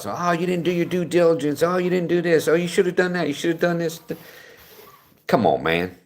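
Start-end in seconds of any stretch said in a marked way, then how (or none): 0.1-4.0 high, whiny mock scolding voice; sing-song nagging imitation of critics. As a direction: parrot the know-it-alls who blame you. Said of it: "I get accused of that whenever I complain".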